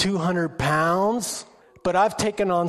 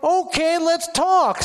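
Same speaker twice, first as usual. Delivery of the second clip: bold acceptance; 11 semitones higher, 5 dB louder, slower than usual. Retold or imitated. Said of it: "I was like".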